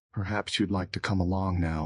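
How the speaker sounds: ordinary delivery, nothing marked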